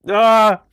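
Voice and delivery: loud, high cry of nerves; loud drawn-out "ah"; frustrated yell. Real, not acted. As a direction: yell out under pressure. Building up to accent attempt.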